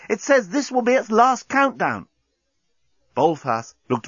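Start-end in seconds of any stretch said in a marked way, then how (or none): 0.1-2.1 high-pitched alarmed warning; urgent cry. A narrator's reading. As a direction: warn urgently, pitched right up. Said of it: "cried Nettie".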